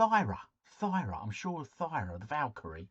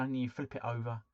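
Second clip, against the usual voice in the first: ordinary delivery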